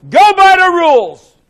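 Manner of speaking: high, loud, drawn-out call; shouted demand. Retold or imitated. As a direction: shout it slowly across the chamber. Retold demand shouted at chairman.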